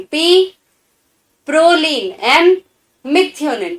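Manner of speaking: ordinary talk, nothing marked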